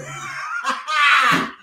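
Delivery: real laughing fit; big laugh. Real, not acted.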